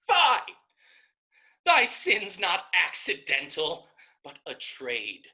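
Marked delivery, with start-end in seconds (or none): 0.1-0.5 high, loud, with a bitter laugh; a sister's contemptuous cry; disgusted shout. Acted. Isabel's disgusted outburst. 1.6-3.9 louder, hard accusing; sharp loud accusation; loud condemnation. Acted. Isabel condemns brother.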